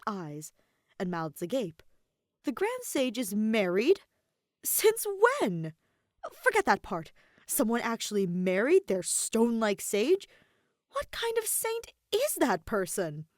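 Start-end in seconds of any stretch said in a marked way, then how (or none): 2.4-13.3 loud, rising disbelief, jumping much higher; shocked gossip. A narrator's reading. Students reacting to the married Grand Sage, loud disbelief. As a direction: gasp it out in scandalized amazement.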